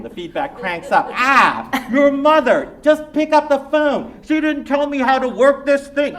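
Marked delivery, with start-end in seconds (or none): 1.1-6.2 loud, high, flustered dad on speakerphone; a father shouting into a new gadget; boisterous Shanghai-born dad, raised voice; excited immigrant father. Acted.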